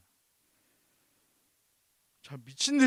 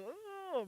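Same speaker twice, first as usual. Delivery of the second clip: stifled sob; 11 semitones higher, 20 dB quieter than usual. Shown as a demonstration. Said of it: "소리가 나가면 안 되니까 막 이불을 막".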